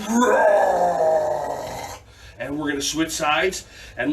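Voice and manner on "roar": loud shouted roar; kids' dragon roar. Shown as a demonstration. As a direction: roar like a fire-breathing dragon. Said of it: "And then dragon fire".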